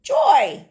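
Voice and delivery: Joy said high and loud for the class; clear teacher model. Shown as a demonstration. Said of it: "Say it with me".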